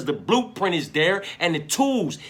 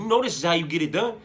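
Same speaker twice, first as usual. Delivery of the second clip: imitated sparring hype; 7 semitones higher, 7 dB louder, faster than usual. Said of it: "I'd be all in his motherfucking ear telling him".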